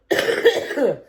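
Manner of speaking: a rough fit of coughing after laughing; real cough. Real, not acted. Vlogger coughing after laughing too hard, real cough. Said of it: "I'll start coughing really bad".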